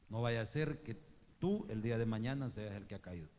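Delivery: ordinary talk, nothing marked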